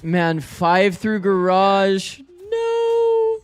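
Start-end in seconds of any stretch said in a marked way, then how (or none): 2.2-3.4 shocked no. Real, not acted. Reacts to bad round.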